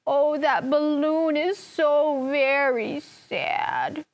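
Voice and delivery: slow, sorrowful voice; sad. Shown as a demonstration. Teacher showing the class a sad voice. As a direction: sound sad about the little balloon. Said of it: "Show me sad".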